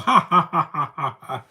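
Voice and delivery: high, loud witchy cackle; evil cackle. Retold or imitated. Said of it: "There's the cackle".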